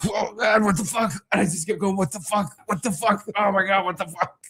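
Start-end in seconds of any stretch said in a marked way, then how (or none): 0.1-1.1 high, strained mock distress; pained panic. Husband retelling dressing for hospital, in pained panic. 2.0-4.4 high, whimpering repetition; pained panic. Retold or imitated. Husband retelling dressing for hospital, moaning in panic. Retelling repeated cries while in pain.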